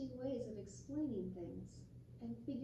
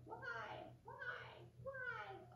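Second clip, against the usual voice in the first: put-on high, softer child's whys; little sibling asking; 11 semitones higher, 10 dB quieter, slower than usual. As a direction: mimic a small child asking. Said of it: "little brothers and sisters saying".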